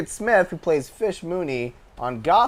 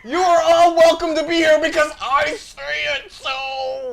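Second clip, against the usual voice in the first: high, loud pompous shouting; mock Wes shout; 13 semitones higher, 10 dB louder than usual. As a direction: bellow like a self-important host. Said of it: "Just don't pretend to be Wes".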